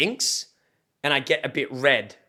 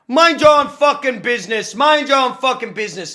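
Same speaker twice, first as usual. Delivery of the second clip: loud, high imitation of an irate man; old man going off; 10 semitones higher, 8 dB louder than usual. Old man to the interfering driver, imitated irate shout. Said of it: "like, going off".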